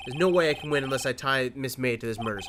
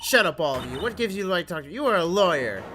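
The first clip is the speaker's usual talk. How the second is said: loud, higher-pitched witness tantrum; angry outburst; 6 semitones higher, 7 dB louder than usual. Acted. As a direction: lash out at the lawyers, hammed up. Witness lashes out at lawyers.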